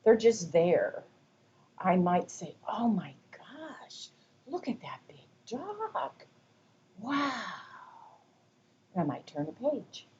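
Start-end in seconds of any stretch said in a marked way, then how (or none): 2.7-7.3 high, hushed wonder; breathless whispered awe over a picture book; excited whisper. Shown as a demonstration. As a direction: whisper with wide-eyed excitement to a toddler. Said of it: "using some enthusiasm in my voice".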